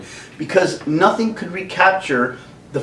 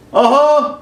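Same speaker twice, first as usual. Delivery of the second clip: higher, casual uh-huh; a kid shrugging it off; mock Luke reply; 10 semitones higher, 4 dB louder than usual. Luke answers Obi-Wan.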